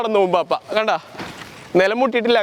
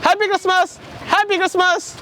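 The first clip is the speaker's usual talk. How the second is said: much higher, cheery greeting voice in costume; costumed Pappanji voice; 9 semitones higher than usual. Pretend. Masked Pappanji greeting passers-by and the vendor, high and cheery. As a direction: play the jolly Christmas figure greeting everyone.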